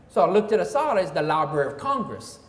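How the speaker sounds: usual speaking voice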